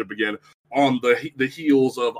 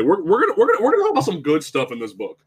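rapid, stumbling, raised-pitch restart; hurried, forced positivity; flustered insistence; 5 semitones higher, much faster than usual. Real, not acted. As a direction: rush to change the subject. Flustered scramble back to good news.

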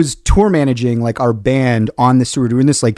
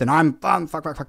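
normal talking voice

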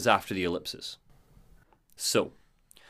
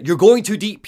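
urgent warning, pitched up and louder; Thomas Zane; 7 semitones higher, 8 dB louder than usual. A narrator's reading. Storyteller voicing a phone call warning Alan, urgent. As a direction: warn him forcefully. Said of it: "Thomas Zane comes through".

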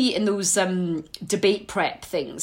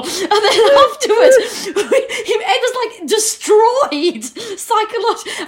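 high, loud, laughing storytelling; 11 semitones higher, 6 dB louder than usual. Real, not acted. Recalling the brutal debate rehearsal, laughing. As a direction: barely hold the laughter in.

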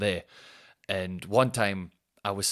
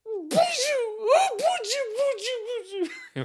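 long, much higher, crying laugh; squeaky wailing laugh. Retold or imitated. Laughing at his own passenger impression, squeaky crying laugh.

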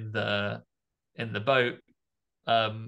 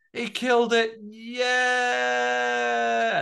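cheering; 12 semitones higher, 3 dB louder, much slower than usual. Retold or imitated. Kids cheering shark dead.

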